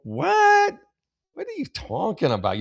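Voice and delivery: high-pitched disbelief, falling as it goes; incredulous. Real, not acted. Host challenging the letter writer, incredulous.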